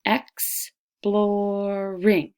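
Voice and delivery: Exploring stretched slowly, beat per syllable; slow sounded-out Exploring. Shown as a demonstration. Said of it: "How many syllables".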